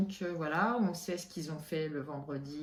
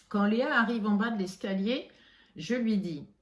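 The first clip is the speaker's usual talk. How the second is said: ordinary talk, nothing marked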